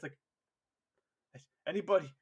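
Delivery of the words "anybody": high, loud, flustered soldier; mock panicked calling. Squad abandoned by its commander, comically panicked calling. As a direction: demand orders like the leader just vanished. Leaderless squad calls out, acted.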